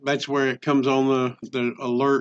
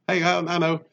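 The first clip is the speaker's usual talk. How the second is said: normal talking voice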